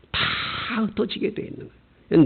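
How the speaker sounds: hushed, whispered repeat of the warning. Acted. Preacher to congregation, whispered warning. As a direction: drop to a whisper to make it sink in.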